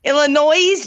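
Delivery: "Illinois" with the final S voiced as a Z; "Illinois" ending in a clear buzzing S; S sounded in Illinois. Shown as a demonstration. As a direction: say "Illinois" to rhyme with noise, leaning on the end. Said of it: "make sure you put the S on it".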